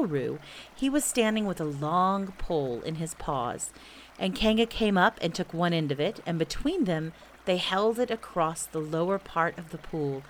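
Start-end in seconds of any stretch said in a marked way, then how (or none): none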